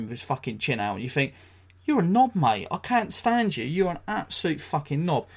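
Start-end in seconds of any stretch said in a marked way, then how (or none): 1.8-5.3 pitched up high, scornful jeer; high mocking put-down; mock insult. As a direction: sneer it at him like a schoolyard taunt. Voicing his inner insult at Hadley.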